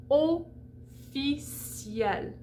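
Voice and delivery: Officiel said very slowly, ee glide into an open è with a clear final l; model pronunciation. Shown as a demonstration. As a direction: draw out Officiel, ofi-see-el. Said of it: "opens up to E because the L is pronounced".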